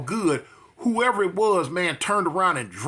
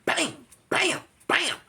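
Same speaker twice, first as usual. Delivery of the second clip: punch noises; 10 semitones higher, slower than usual. Retold or imitated. Hits imitated.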